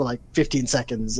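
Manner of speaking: ordinary delivery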